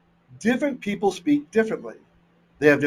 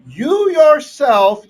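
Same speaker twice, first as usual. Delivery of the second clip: "yourself" stretched out slowly, high and loud; emphatic; 10 semitones higher, 11 dB louder, much slower than usual. Acted. Jacob insisting to Laban, you yourself, heavy emphasis. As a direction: lean hard on "yourself", drawn out. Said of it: "speaking with special emphasis".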